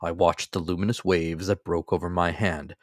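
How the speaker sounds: plain reading voice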